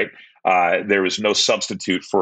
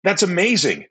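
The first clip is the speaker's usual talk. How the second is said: excited retelling; 9 semitones higher than usual. Retold or imitated. Excited retelling of dates' reactions.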